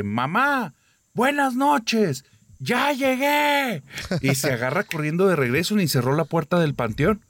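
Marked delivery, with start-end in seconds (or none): 0.0-3.8 very high, slow, drawn-out yell; louder shout. Retold or imitated. Girl yelling into the cemetery to prove her dare, slow full shout. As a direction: stretch the words into a full-throated shout. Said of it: "y lo grita más fuerte".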